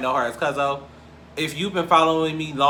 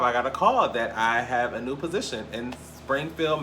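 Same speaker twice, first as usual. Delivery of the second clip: ordinary delivery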